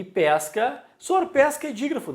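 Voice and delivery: high, quick mock student protest; student objecting. Teacher mimics a pupil objecting, high and quick. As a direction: play a student cutting in to correct the teacher.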